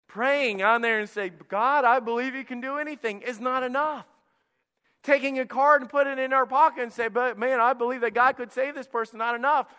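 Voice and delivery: loud, high and driving, pushing the point home; preaching at full force. Acted. Pastor urging his church off the pew, loud preaching. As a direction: preach it hard to the congregation.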